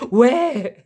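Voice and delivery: «Ouais!» aigu et plus fort; joie réelle. Real, not acted. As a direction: petit cri de victoire. Découvre la lettre U. (trouve le U dans le tirage, cri joyeux)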